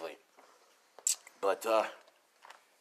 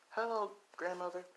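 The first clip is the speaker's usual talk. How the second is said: small, higher, softer voice; shy child; 6 semitones higher, 7 dB quieter than usual. Acted. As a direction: greet timidly from behind mother's leg. Scared child meets her grandmother.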